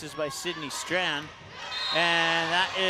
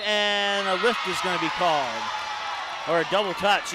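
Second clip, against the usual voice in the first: louder, emphatic ruling call; 5 dB louder than usual. Real, not acted. Announcer calls the lift to viewers, loud. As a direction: call the violation firmly. Calling a violation on the play.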